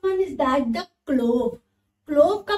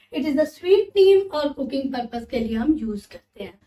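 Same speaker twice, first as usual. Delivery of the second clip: usual speaking voice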